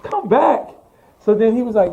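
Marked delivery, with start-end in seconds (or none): 0.0-0.7 retold defiant excitement. Defiant answer to team's complaint.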